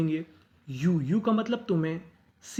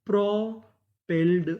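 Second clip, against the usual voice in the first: Propelled said slowly, syllable by syllable; teacher drawing out propelled; slow careful reading; much slower than usual. Shown as a demonstration. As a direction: stretch propelled out for learners. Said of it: "तो meaning क्या बनी?".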